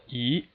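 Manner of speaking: E rising steadily; second tone. Shown as a demonstration. Teacher shows learners the second tone, steadily rising E. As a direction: slide E upward with no dip at all. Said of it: "there's no dip at all".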